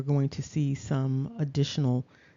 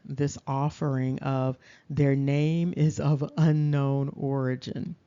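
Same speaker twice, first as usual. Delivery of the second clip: normal talking voice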